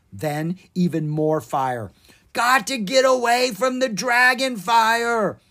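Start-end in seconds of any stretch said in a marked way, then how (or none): 2.3-5.4 high, loud ogre voice fleeing in panic; panicked scream. Acted. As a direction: yell while scrambling away. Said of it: "Osgood screamed".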